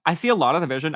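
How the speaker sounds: ordinary delivery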